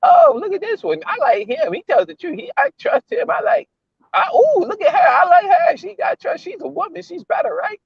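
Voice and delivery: high, far falsetto mimicking a gullible voter; mocking gullible voter. Mocking people who fall for politicians in high falsetto. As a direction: squeal it like a naive fan of politicians. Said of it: "people keep falling for".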